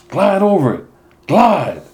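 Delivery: high, loud trackside shout; coach yelling. Acted. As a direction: yell it across the track to a sprinting runner. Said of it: "Still yelling".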